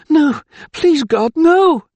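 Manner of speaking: high, loud desperate cry of grief; anguished cry. A narrator's reading. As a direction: cry out in horror as he falls. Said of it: "cried Lady Lydia Farrell".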